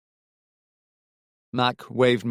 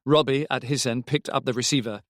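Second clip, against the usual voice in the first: plain reading voice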